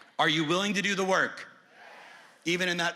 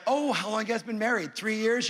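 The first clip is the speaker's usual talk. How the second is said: higher, mock-friendly question; fake-sweet small talk with a couple; hammed-up chatty agent; nosy agent; 6 semitones higher, 3 dB louder than usual. Agent voicing chat with couple.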